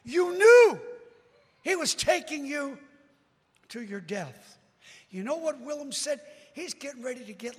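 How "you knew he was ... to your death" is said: raised, higher challenging call; acted-out loud accusing bystander; second crowd heckler. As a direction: demand an answer from across the crowd. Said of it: "Somebody else says".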